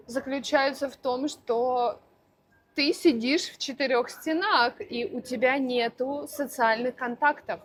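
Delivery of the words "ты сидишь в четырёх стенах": ordinary talk, nothing marked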